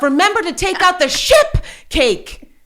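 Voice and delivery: high, loud, drawn-out mock yelling; screamed self-reminder. Mom invents a cake excuse for her son, screamed reminder. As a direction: holler a slow reminder to yourself.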